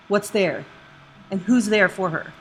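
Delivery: ordinary talk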